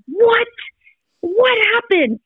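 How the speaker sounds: high alarmed cry of surprise; panicked what happened. Retold or imitated. Mom seeing her bleeding son, high alarmed cry. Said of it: "my first reaction was like".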